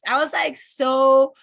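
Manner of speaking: reliving being upset. Retold or imitated. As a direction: pitch jumps high and loud as the upset comes back. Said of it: "so upset".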